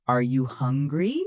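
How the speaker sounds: "hungry" swept upward at the end; rising intonation. Shown as a demonstration. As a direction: lift the voice on "hungry" as a yes-no question. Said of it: "My voice goes up on hungry".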